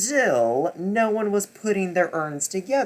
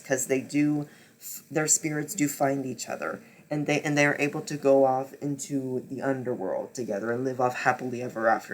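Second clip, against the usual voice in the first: normal talking voice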